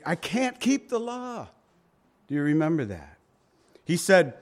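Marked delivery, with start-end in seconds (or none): 0.1-1.5 higher-pitched voice of a despairing believer; quoting the people. Retold or imitated. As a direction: voice them giving up on keeping the law.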